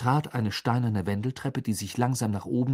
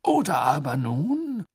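higher, drawn-out, faltering start; embarrassed; 6 semitones higher, 4 dB louder, slower than usual. A narrator's reading. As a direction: stall awkwardly, searching for words. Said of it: "Fudge klang verlegen".

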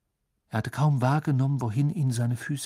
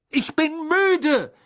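much higher, louder, slow; exasperated shout; 7 dB louder, slower than usual. Acted. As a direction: finally bellow it over the party. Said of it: "brüllte er schließlich".

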